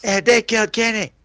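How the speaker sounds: high put-on cartoon voice; South Park. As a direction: squeal it as a cartoon catchphrase parody. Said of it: "like, South Park".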